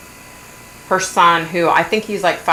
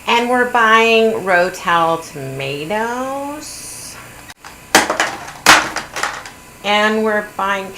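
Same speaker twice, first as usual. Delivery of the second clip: slow, drawn-out mock vlogger voice; mocking the wife filming; 2 semitones higher, much slower than usual. Mocking the wife narrating her grocery haul, slow mock voice. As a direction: drag every word out like a smug haul video. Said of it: "So she's like this".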